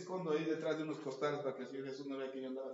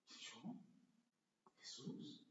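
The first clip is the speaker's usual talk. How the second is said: timid whisper; 5 semitones lower, 18 dB quieter, much slower than usual. Acted. Disciples unsure, hushed.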